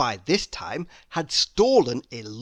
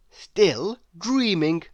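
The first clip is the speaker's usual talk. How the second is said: ordinary delivery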